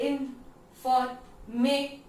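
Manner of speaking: इन, for, में as separate punched beats; syllable by syllable. Shown as a demonstration. Teacher breaking information into sounds, syllable by syllable. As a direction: pause after each of इन, for, में. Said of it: "एक sound complete हो जा रहा है".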